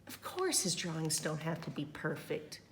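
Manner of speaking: ordinary delivery, nothing marked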